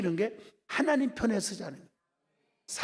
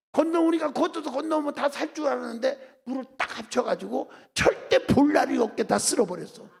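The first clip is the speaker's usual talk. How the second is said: loud, high-pitched sermon delivery; impassioned preaching; 5 semitones higher, 6 dB louder than usual. Real, not acted. Preacher telling the congregation of the Red Sea miracle, impassioned. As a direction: preach it at full force, voice lifted.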